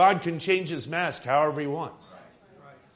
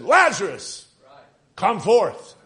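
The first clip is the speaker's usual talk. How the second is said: loud, raised preacher's call; 3 semitones higher, 6 dB louder than usual. Acted. Preacher acts out Jesus calling at the grave of Lazarus, loud voice. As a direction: call it out loudly, pausing between name and command. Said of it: "he spoke with a loud voice".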